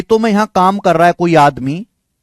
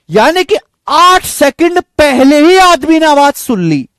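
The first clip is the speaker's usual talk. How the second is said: higher and louder, punching the point home; excited reveal; 7 semitones higher, 4 dB louder than usual. Real, not acted. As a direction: announce the answer with triumph. Teacher reveals the key point. (जोश से ऊँची और तेज़ आवाज़ में)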